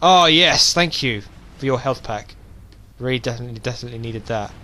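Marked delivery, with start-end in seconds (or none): none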